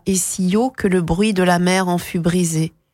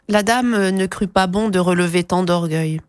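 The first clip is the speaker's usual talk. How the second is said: usual speaking voice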